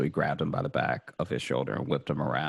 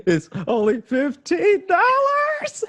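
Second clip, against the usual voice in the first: much higher, slow and loud, a squeal of disbelief; squeaky high exasperation; 8 dB louder, slower than usual. Real, not acted. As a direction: squeak it out, half laughing. Exasperated complaint.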